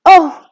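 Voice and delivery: loud high gasping oh; gasped oh; shocked gasp. A narrator's reading. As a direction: gasp in horror. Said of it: "gasped Homily".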